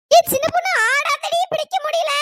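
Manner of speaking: high, loud protest; strained urgent shout. Acted. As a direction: shout while struggling to hold someone. Carriers struggle with thrashing friend.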